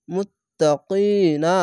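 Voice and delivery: মুত্তাকিনা slowly, কি drawn out, ending stopped; slow Quran recitation; recited verse ending. Shown as a demonstration. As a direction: draw out the long vowel and stop. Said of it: "শেষ অক্ষরে সাকিন দিবেন".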